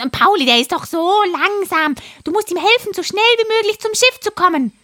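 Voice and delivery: high, fast squawky parrot. Acted. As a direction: chatter like an excited parrot. Parrot character voice.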